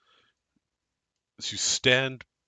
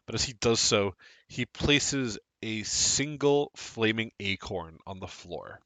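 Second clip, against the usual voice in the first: scene narration; 5 dB quieter than usual. Acted. Game master narrates the scene.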